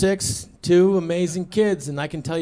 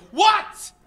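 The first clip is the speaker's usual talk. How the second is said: startled what; 12 semitones higher than usual. Retold or imitated. Wife woken up, snapping.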